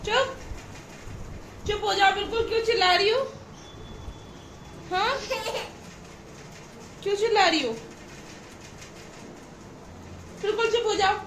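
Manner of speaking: high, firm mock scolding. Real, not acted. Mother playfully scolds her screaming toddler, high and firm.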